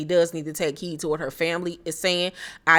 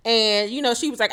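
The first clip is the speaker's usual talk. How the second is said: normal talking voice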